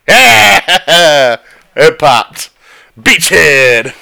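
loud, high victory whoop; excited cheer. Real, not acted. Celebrating a kill.

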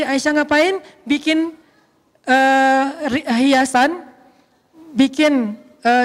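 usual speaking voice

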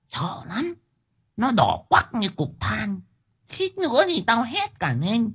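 high-pitched gossip giving a scary account; spooked village wife. Acted. Liên recounts a scary night sight.

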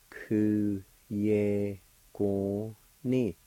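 very slow "Ku ye go ni", a pause after each syllable. Shown as a demonstration. Teacher repeating the word for July, slowly syllable by syllable. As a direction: stretch out Ku, ye, go, ni separately. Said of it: "it's pronounced like this".

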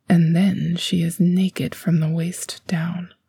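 ordinary delivery

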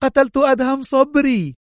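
spy bursting in to announce her kill; joyful cry. A narrator's reading. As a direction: cry it out in jubilant triumph. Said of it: "صاحت وهي تقفز في حبور".

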